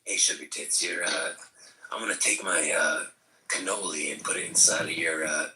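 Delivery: low, leering pickup-line voice; lightly voiced lowlife hitting on someone; sleazy flirt impression. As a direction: sleaze it up like a creep at a bar. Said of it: "Say something about your pita pocket".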